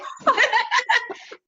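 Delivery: sudden high-pitched laugh; high burst of laughter. Real, not acted. Amused by her own blunt Russian impression, high laugh.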